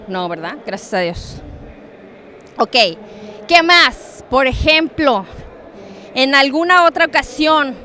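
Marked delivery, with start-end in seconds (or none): none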